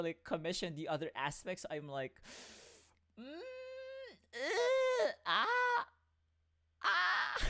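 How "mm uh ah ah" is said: cartoon laugh. Real, not acted. Whiny squeal over lacking money.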